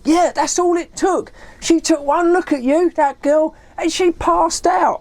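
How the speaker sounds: high-pitched, exasperated retort; retold rant, slowing on the last words; worked up. Dave blaming his friend for the girl fainting, worked up. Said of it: "He was so worked up".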